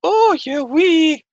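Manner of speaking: very high, slow Scottish granny voice; shrill-pitched Scottish nanny scolding; hammed-up Scottish accent; Mrs Doubtfire Scottish. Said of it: "think of, um, Missus Doubtfire".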